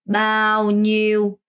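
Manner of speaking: "bao nhiêu" very slow, tongue sweeping the palate; slow teacher model of "bao nhiêu"; careful pronunciation. Shown as a demonstration. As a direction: stretch "bao nhiêu" out for the learners. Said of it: "니우 안 돼요".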